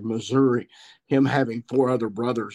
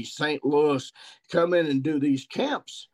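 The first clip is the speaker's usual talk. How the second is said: normal talking voice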